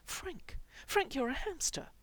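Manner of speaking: high, urgent appeal; desperate. A narrator's reading. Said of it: "Mikey said desperately".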